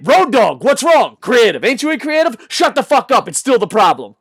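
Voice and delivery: loud, high back-and-forth; acted argument. Host acting out a heated Road Dogg exchange, loud. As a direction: snap at him like a heated interview.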